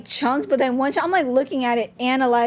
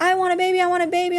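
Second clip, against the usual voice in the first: higher, mildly mock upset child repeating herself; a touch of a cross toddler; mad little daughter; 6 semitones higher, faster than usual. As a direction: imitate the daughter insisting, pitched up. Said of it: "my daughter got so mad".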